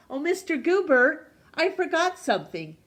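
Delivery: high, loud call from the porch. A narrator's reading. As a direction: call after him down the sidewalk. Mister Hatch calls from porch.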